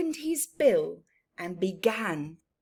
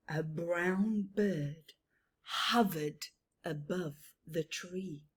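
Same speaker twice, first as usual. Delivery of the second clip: ordinary delivery, nothing marked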